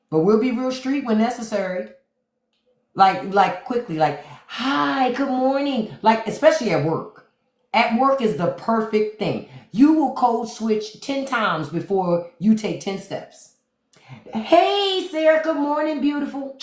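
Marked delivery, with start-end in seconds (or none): 4.5-6.0 bright, higher work-voice hello; chipper office greeting. Acted. To coworkers at work, a higher polite professional hello. 14.3-16.6 much higher, sugary office hello; sing-song work greeting. Acted. Greeting Sarah at the office, a much higher cheery professional voice.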